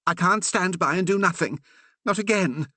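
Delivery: high, urgent exclamation; exclaiming. A narrator's reading. Curate exclaiming to the Reverend, high and urgent. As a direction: burst out, pleading to act. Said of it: "Percy exclaimed".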